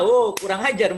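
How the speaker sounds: ordinary talk, nothing marked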